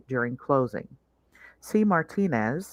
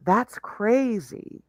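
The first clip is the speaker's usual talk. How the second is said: "That's" stretched slow and high, "crazy" falling; "That's" sung out long and high; drawn-out stress on THAT'S; 7 semitones higher, much slower than usual. Shown as a demonstration. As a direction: lean hard on "That's" in "That's crazy". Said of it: "I'm not adding the emphasis on that syllable".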